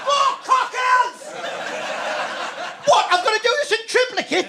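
hammed-up exasperated outcry; mock shouted despair. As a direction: yell it like a man buried in paperwork. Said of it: "it's me just going".

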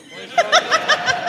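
squealed very high and slow through laughter; laughing squeal. Real, not acted. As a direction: stretch it out in disbelieving laughter. Incredulous laugh at the book.

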